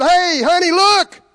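high, loud call across the room; excited call. Retold or imitated. Healed man calling his wife across the room, excited.

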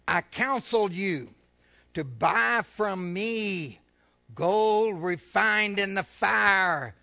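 slow, loud pulpit reading; slow loud preacher reading Revelation; solemn scripture reading. Acted. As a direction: proclaim each phrase slowly. Scripture read.

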